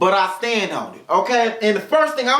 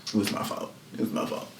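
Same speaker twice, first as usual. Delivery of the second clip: unvoiced, much softer aside; whispered aside; 13 dB quieter, faster than usual. Real, not acted. Admitting to herself it was her fault, whispered. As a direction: whisper it under your breath.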